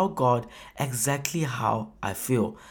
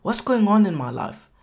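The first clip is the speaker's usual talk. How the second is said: higher, louder bewildered question; confused cry; 5 semitones higher, 4 dB louder than usual. Retold or imitated. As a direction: sound lost and baffled. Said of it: "he expresses confusion".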